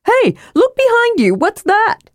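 very high, startled-sounding call; high-pitched alert from a little fellow; urgent cry. A narrator's reading. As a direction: cry out to make him look. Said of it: "Tass cried".

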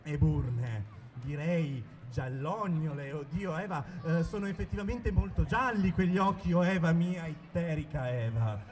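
mock-romantic poem reading; poem recitation. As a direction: recite like a tender love poem. Recites a comic love poem. (recitazione poetica ironica)

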